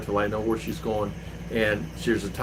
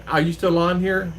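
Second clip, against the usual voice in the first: ordinary talk, nothing marked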